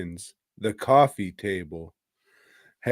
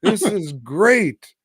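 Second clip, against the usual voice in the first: high, hammed-up amazed baby; baby's voice; 9 semitones higher, 3 dB louder than usual. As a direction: play a wonderstruck infant, building higher. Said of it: "And the baby's like".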